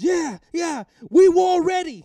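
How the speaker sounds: high, loud imitated war cry; fired-up fighter. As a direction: shout back ready for battle. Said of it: "And he goes".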